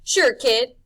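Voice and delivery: loud, high, slick charm; charming Rictus. A narrator's reading. Rictus charms Harvey the kid, loud and slick. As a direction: lay on the charm. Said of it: "persuaded that his charm was working".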